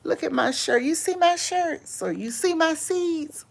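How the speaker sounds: a light impression of Unbiased; imitating Unbiased. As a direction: mimic her proud showing. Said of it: "Unbiased says".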